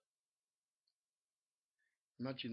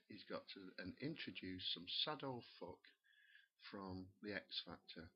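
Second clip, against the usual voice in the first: ordinary talk, nothing marked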